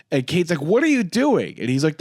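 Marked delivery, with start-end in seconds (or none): 0.6-1.6 high-pitched mock disbelief; imitated bewildered reaction; incredulous question. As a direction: ask it high, as if baffled. Kate reacts to Drew on ground.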